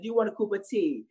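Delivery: put-on high, playful English accent. Offering fans tea as a joke, English accent. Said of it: "started doing an English accent".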